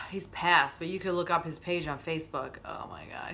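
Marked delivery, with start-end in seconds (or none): 2.7-3.3 breathless whispered oh my God, much lower; whispered gush. Real, not acted. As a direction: hush it like you can't believe the cuteness. Gushing over a cute dog.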